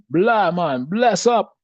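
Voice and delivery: high, loud mock Jamaican accent; Sanya. Showing Jeff how to say it, exuberant mock Jamaican accent. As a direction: belt it out in an imitated Jamaican accent. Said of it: "say it like, uh, Sanya used to say it".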